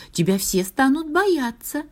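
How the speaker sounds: high-pitched star voice; high, persuasive little star; tempting star. A narrator's reading. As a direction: coax in a high storybook voice.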